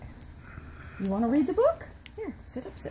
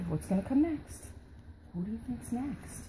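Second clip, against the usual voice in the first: ordinary talk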